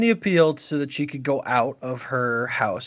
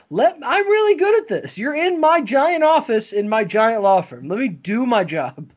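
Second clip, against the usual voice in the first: cocky boss Tegan; 8 semitones higher, 3 dB louder than usual. Retold or imitated. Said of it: "She's like".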